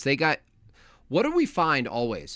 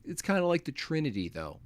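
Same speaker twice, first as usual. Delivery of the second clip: mock Vivek; 5 semitones higher than usual. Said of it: "He goes".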